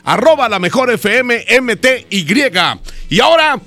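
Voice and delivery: punchy DJ patter with stretched key words; radio announcer. Acted. Host introduces a song contest to listeners, radio announcer delivery.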